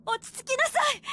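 loud, high firm command; stern shout. Acted. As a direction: order her to calm down. Mother commands.

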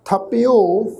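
ਥਪਿਓ with i gliding into a long o; pronunciation demo. Shown as a demonstration. Teacher answering how the first word is pronounced, careful demo of ਥਪਿਓ. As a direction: model ਥਪਿਓ slowly, holding the final -io. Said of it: "pronunciation ਹੋਏਗੀ ਜੀ".

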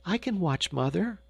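a hint of a child urging her mother; urging young girl. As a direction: press the offer, lightly voiced little girl. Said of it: "urged Gretel".

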